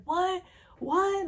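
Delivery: high-pitched imitated "what?"; shocked disbelief. Friend hearing Laura got jumped, shocked disbelief.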